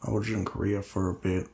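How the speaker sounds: low, quiet, halting role voice; subdued Linus, weary and hesitant. Acted. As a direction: speak low and softly, with hesitant pauses. Game master voices Linus.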